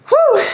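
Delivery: high, loud exhale; winded "whew". Real, not acted. Coach recovering after the set, high "whew". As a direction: blow out relief after effort.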